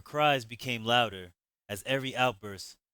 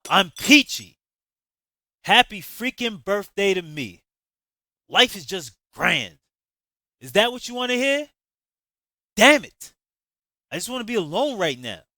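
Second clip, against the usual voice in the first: furious, high, loud sarcastic scream; 10 semitones higher, 15 dB louder than usual. Acted. Javon blowing up at Danny in the kitchen, furious screaming. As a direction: rage at the friend who keeps asking. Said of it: "and screaming".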